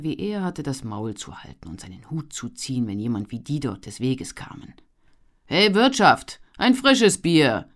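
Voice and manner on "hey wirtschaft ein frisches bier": loud call across the tavern, slow. A narrator's reading. Poor young man calling across the tavern for beer, loud call. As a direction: shout for the innkeeper. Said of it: "rief er".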